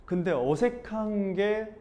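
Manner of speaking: "어색한 게" drawn out slowly, leaning on "게"; slow, deliberate. Shown as a demonstration. Thinking it through to himself, slowly drawing out "어색한 게". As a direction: let "어색한 게" stretch out slowly and weigh "게".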